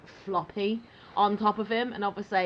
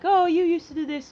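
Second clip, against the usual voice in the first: mock nostalgic; 9 semitones higher, 7 dB louder than usual. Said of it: "he'll be like".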